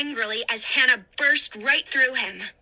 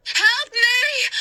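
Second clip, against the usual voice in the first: frightened cry; 10 semitones higher, 5 dB louder than usual. A narrator's reading. Trapped boy cries.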